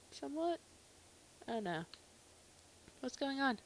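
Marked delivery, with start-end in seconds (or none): none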